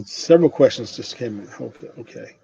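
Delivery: ordinary delivery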